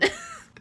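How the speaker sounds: high little giggle after the burn; squeaky laugh. Real, not acted. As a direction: giggle at her own comeback. After her burn line.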